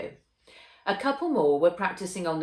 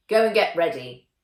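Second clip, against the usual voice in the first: firm, louder order to the students; firm command; 9 dB louder than usual. A narrator's reading. Teacher sending the girls off, firmly. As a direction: send them off with a firm word.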